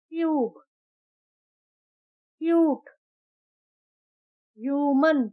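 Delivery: ट्यूब क्यूट ह्यूमन with a long yoo; long u sound. Shown as a demonstration. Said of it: "यू sound के जो word है ... long vowel में". (लंबा यू sound)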